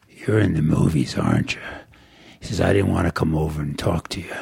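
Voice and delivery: put-on low gruff old man; rough, low stranger's voice. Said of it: "in this real gruff voice".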